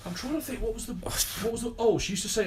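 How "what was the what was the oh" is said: normal talking voice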